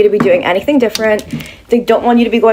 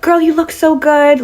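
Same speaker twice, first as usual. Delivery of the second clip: higher, drawn-out imitation of an admiring girl; gushing compliment; 7 semitones higher than usual. Girl complimenting a stranger in the street, imitated gushing. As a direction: gush it slowly and high. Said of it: "girls will stop me in the street".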